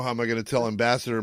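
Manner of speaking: ordinary talk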